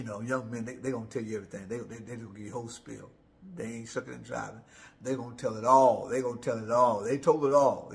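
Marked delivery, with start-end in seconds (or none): 5.0-7.9 loud, emphatic repetition; emphatic preaching. Real, not acted. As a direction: drive it home like a preacher.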